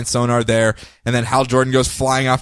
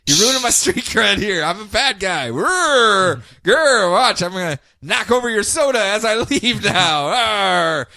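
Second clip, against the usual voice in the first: lightly hammed-up fake villain; 5 semitones higher than usual.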